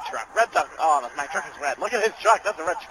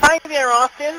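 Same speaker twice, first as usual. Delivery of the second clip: higher sing-song hello with a rising end; high sing-song greeting; 7 semitones higher than usual. Pretend. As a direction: greet him up high and playful. Teasing greeting to Austin.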